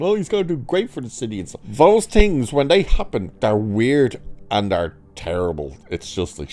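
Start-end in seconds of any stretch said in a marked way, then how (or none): 0.0-1.4 high, quick, gushing voice of a passerby. Retold or imitated. Mimicking people on the street, fast and high. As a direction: rattle it off like an eager fan. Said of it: "And people going".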